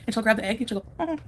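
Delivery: usual speaking voice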